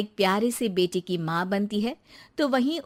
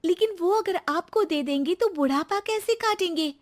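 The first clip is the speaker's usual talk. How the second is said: lightly raised voice of a hurt new bride; young wife Rekha; 10 semitones higher than usual. Acted. New bride answers her husband, a touch hurt.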